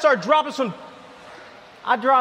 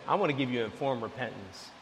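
much softer and lower, gentle offer; tender Jesus; 10 semitones lower, 12 dB quieter than usual. Acted. Jesus offering repentance to the sinner, soft and gentle. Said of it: "he says".

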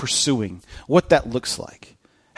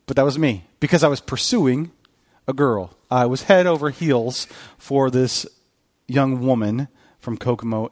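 usual speaking voice

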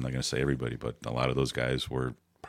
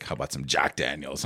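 lightly imitated Russian accent; a hint of a Russian accent, offering a drink. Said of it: "you imagine whatever Russian accent you would imagine".

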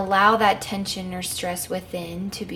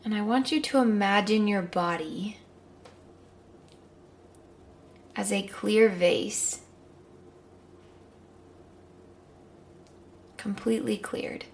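calm guided meditation. Acted.

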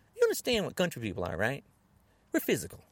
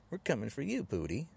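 ordinary delivery

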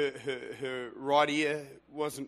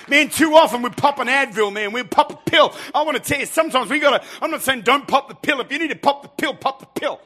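high, loud preacher's appeal; fervent revival delivery; impassioned preaching; 11 semitones higher, 17 dB louder than usual. Real, not acted. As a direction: urge the congregation at full voice. Preaches at full force.

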